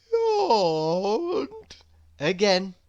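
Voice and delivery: sleepy yawn. A narrator's reading. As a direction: let the word melt into a yawn. Narrator acts out a yawn.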